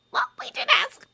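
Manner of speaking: very high squeaky little wizard; squeaky Flitwick. Acted. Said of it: "squeaked Flitwick".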